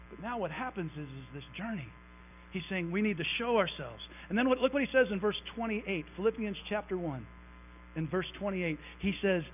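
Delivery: ordinary delivery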